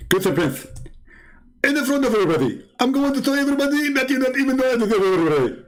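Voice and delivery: high, fast squeaky taunting voice; mock Zakir Naik. Caricature caller threatens to shame the host, high and fast. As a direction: rattle off a threat like a cartoon. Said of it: "I'm Zakir Naik".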